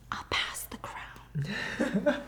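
slow hushed promise; slow whisper. Acted. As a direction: breathe it out slowly, secretive. Sibling's sly promise to brother.

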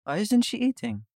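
high-pitched, Dracula-like accent attempt; badly faked accent, pitched well up; failed foreign accent. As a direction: try a friend's accent, landing near Dracula. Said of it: "I can't do her accent".

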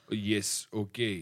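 put-on low, hushed Russian accent. Captive agrees to be Russian, low Russian accent. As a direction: imitate a meek Russian reply, low and soft. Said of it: "I gotta catch the accent".

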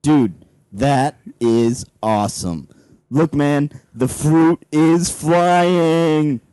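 very slow, dreamy stoned wonder. Acted. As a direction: drawl it out, stoned out of your mind. Stoned man marvels at fruit.

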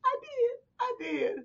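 real helpless laughter. Real, not acted. Kim admitting it while laughing helplessly. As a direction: gasp it out while cracking up. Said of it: "this is what she was doing".

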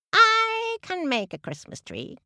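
high, slow proud peacock. Acted. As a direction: boast it grandly. Peacock boasting.

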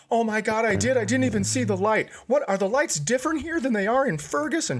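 put-on high, panicked teen voice; losing her mind. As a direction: mimic the daughter freaking out about running the light. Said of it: "She's, like, losing her mind".

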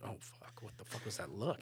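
hushed, breathy whispered thought; whispered panic. Retold or imitated. Thinking during the failing pitch, panicked whisper. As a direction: whisper it to yourself in dread.

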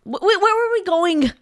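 very high, stammering mock demand; mocking rude imitation. Woman mimics partner interrupting a friendly chat, rudely. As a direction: cut in with an exaggerated pushy mimicry. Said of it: "You say it like this".

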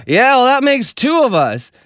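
high mock dry comeback; husband's comeback. Husband retorting to wife, high mock dry voice. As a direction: fire back wryly. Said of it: "the husband goes".

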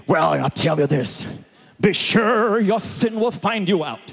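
put-on preacher voice, rising into proclamation; condemning preacher. Preacher warning sinners they will be found out, put-on sternness. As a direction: warn them like a stern old-school preacher.

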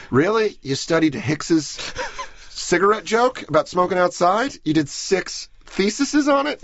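hammed-up incredulous questioning. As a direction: question it in drawn-out disbelief. Imagined listener questions obsessive study.

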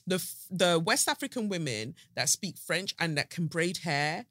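usual speaking voice

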